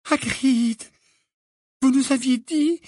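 put-on high, halting young witch; frightened stammer. A narrator's reading. Hermione confronts Hagrid, wand trembling, high and stammering. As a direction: falter on a high, anxious voice. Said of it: "balbutia Hermione".

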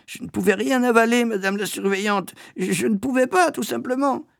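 put-on very high, faltering child whine; plaintive whining. A narrator's reading. Boy insisting to the matron he couldn't eat, whining. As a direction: protest feebly in a high, pitiful voice.

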